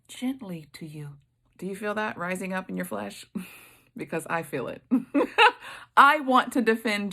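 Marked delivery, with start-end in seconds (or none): 0.1-1.2 a soft whisper mocking the friends' gentle words. Preacher whispering the friends' so-called gentle words. 4.9-5.9 a sudden high burst of laughter. Real, not acted. Preacher laughing at her own flesh rising up.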